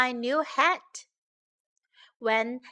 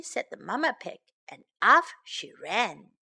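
ordinary delivery, nothing marked

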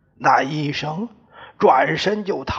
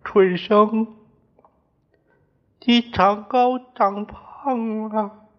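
higher, tearful voice that breaks; crying; 6 semitones higher than usual. A narrator's reading. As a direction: speak through tears to an old friend, higher. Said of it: "我哭着对他说".

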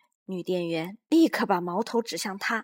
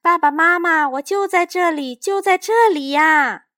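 high, loud, slowing desperate cry; boy yelling to his parents; desperate shout; 9 semitones higher, 13 dB louder than usual. A narrator's reading. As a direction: beg them to see you. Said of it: "小东尼大叫".

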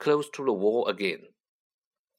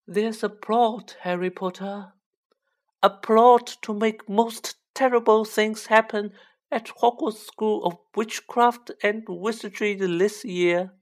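high, voiced elf warning, not whispered; small creature's dire high-pitched warning; fearful house-elf; 9 semitones higher, 4 dB louder than usual. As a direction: reveal the plot high and deliberate. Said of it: "whispered Dobby".

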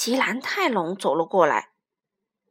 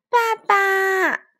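put-on high, drawn-out child's cry for Daddy; high-pitched happy child's call; baby dinosaur calling; 8 semitones higher, 7 dB louder than usual. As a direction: call out eagerly like a little one. Baby returns, calling out to father.

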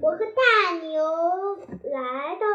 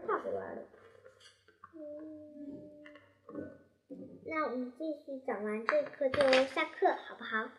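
soft classroom aside; 2 semitones lower, 6 dB quieter than usual. Real, not acted.

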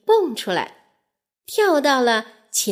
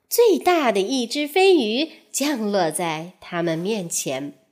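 usual speaking voice

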